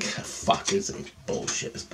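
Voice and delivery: unvoiced-soft, slow dread to self; quiet, sinking disbelief; hushed realisation. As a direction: breathe it out under your breath, slowly. Dawning realisation, said to himself.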